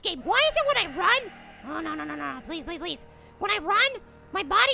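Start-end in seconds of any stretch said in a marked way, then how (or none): 1.7-3.1 low, very soft begging; hushed pleading. Real, not acted. Player dreading the monster while alone, hushed pleading. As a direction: plead under the breath.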